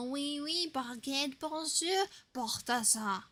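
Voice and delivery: a hammed-up French accent on oui, baguette, bonjour and Balthazar as Baltasah; mock French accent. Streamer jokingly saying the NPC's name in a mock French accent. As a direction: play a cartoon Frenchman, rolling the r in "Balthazar". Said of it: "Try and say Balthazar in a French accent".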